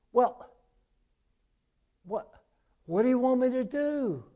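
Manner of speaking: loud, high startled shout; eager yell. Acted. Dangling man shouts up to the voice, loud and high. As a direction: call back up in frantic hope. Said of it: "the Episcopalian yelled out".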